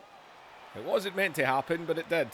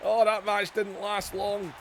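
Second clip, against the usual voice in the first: high, loud, breathless wrestling commentary; excited play-by-play; 9 semitones higher, 3 dB louder than usual. Acted. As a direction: call the match like every move is a shock.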